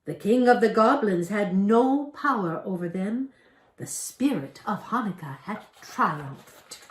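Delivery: plain reading voice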